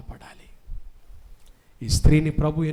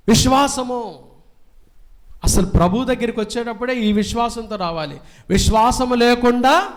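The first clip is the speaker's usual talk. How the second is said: raised, ringing pulpit voice; fiery preaching; 6 semitones higher, 5 dB louder than usual. Acted. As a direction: preach it out to the whole church. Preaching scripture with force. (ఉత్సాహంగా బోధించే స్వరం)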